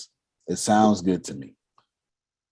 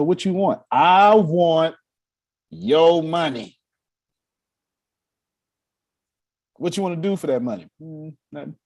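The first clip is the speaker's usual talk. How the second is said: acted exchange; 6 semitones higher, 3 dB louder than usual. Voicing employee and boss dialogue.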